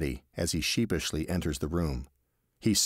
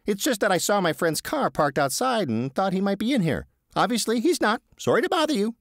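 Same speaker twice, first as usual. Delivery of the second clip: higher, apologetic Teddy; sheepish Teddy; 5 dB louder than usual. Acted. Teddy apologising to the receptionist, higher sheepish voice. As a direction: backpedal politely, a little flustered. Teddy excuses himself nervously.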